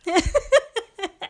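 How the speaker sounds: a sudden high, loud burst of laughter. Real, not acted. Laughing at herself.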